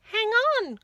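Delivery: high, loud quacking call; quacked. A narrator's reading. Duck quacks to stop the rat eating her. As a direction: squawk it out like a duck. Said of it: "quacked the duck".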